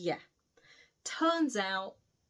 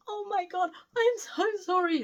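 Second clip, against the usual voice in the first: high, loud tearful apology; mock crying; 11 semitones higher, 4 dB louder than usual. Best friend caught in the bedroom, mock crying. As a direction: put on the waterworks, pitched way up. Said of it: "like, crying and all the waterworks".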